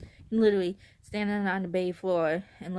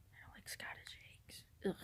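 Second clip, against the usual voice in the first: whispered aside; 17 dB quieter than usual. Real, not acted. Aside about disliked food.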